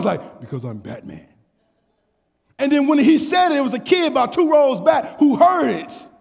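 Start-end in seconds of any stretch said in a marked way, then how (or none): 0.4-1.3 very low, soft superhero voice; Batman voice. Retold or imitated. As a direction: sink deep and quiet for the hero line. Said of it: "he said it in the voice".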